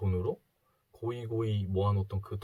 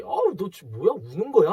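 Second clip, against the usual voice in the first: louder, higher mocking jeer; teasing taunt; 7 semitones higher, 8 dB louder than usual. Rich classmate jeers at the crying boy, loud and mocking. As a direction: needle him like a bratty classmate. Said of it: "막 이러면서 놀려대는 거예요".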